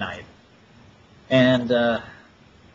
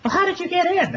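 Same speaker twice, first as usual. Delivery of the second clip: very high, squeaky girly imitation; ditzy high-pitched question; mock stripper voice. Mocking the stripper asking how he got in, squeaky high.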